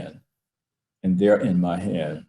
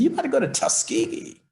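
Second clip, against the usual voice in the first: higher, faster, a voiced father swelling with pride; proud father; faster than usual. Father telling his son he is going to Tuskegee, proud higher voice. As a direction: let the father's pride lift the line up high.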